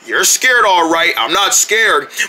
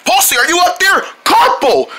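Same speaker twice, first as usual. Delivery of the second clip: calling out; 9 semitones higher than usual. Real, not acted. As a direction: shout his name into the dark. Calling out in dark.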